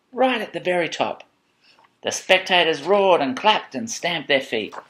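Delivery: high, lively race narration; excited storytelling. A narrator's reading. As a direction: drive the climb upward for a child. Reads picture book to child.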